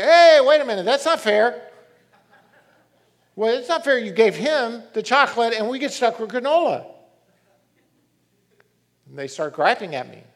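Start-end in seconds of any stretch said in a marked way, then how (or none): 0.0-6.8 put-on loud, high outraged kids; pitched-up shouting "not fair"; kids protesting. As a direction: yell like cheated brothers. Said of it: "The other two say".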